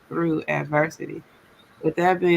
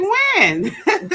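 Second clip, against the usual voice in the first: eager; 14 semitones higher, 5 dB louder than usual. Retold or imitated. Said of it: "everybody was like".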